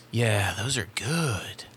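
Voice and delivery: slow, hushed, savoring murmur; soft, lingering whisper into the mic; mock ASMR whisper. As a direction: whisper it with drawn-out pleasure.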